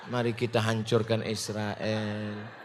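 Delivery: mock-gentle, very soft, low and slow; lemah lembut dibuat-buat. Shown as a demonstration. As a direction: drag it out in a tiny meek voice. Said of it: "mau ngomongnya lemah lembut". (sangat pelan dan rendah, pura-pura lembut)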